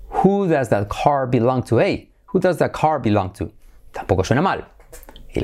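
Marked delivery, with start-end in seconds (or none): none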